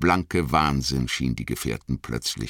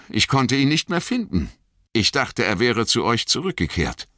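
raised pitch, building agitation; Boromir recounting, agitated; 7 semitones higher, 3 dB louder than usual. A narrator's reading. Boromir explains Frodo's disappearance to the others, agitated. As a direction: grow higher and more agitated as you explain.

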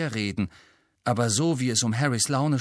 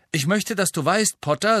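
raised, high and loud, carrying across the room; 9 semitones higher, 4 dB louder than usual. A narrator's reading. Ernie declaring loudly to Harry before the class, loud and carrying. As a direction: proclaim it so everyone hears. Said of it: "sagte er mit lauter, tragender Stimme".

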